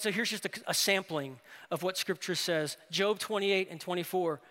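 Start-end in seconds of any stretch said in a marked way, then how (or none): none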